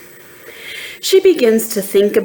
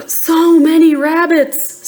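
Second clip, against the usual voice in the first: surprised; 8 semitones higher than usual. Acted. Trixie surprised by rabbits.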